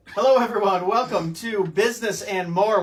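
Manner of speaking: bright-voiced, projected show opening; show host intro. Real, not acted. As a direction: greet the audience as a presenter. Host starts the recorded show.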